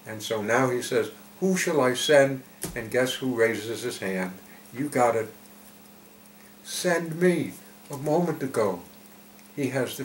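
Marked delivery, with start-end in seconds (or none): 1.4-2.4 raised, louder call. Acted. God seeking a messenger for lost children, calling out. 6.6-7.5 high, eager offer; eager volunteering. Acted. Isaiah answering God's call, volunteering eagerly.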